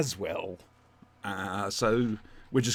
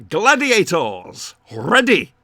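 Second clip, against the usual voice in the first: game show announcer; 5 semitones higher, 11 dB louder, slower than usual. Acted. Hosting a mock game-show start.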